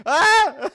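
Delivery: sudden high loud yelp of surprise; startled yelp. Real, not acted. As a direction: let out a sharp shocked squeal. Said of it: "That was so loud".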